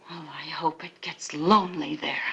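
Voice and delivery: low, hushed bitterness; soft, cutting farewell; bitter parting shot. Acted. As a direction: drop to a near whisper, wishing him ill.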